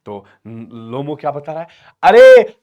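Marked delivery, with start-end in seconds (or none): 2.0-2.6 high, very loud exclamation; protesting suitor. Acted. Suitor objecting to daughter's concession, loud protest. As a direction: burst out in mock indignation.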